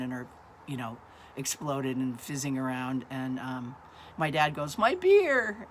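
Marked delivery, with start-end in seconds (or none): 4.8-5.7 high, loud wail of loss; imitating a dad's comic lament; mock dismay. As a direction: cry out in playful anguish. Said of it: "trying to keep it light".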